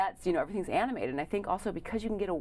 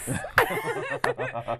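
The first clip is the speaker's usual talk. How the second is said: high squealing laugh. Pretend. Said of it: "in her character voice".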